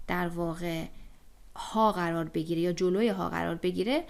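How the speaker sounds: ordinary talk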